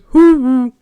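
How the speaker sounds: loud, high owl-like hoot; curious giant bird hooting. Acted. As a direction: hoot at them like a big bird. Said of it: "looks at you and goes".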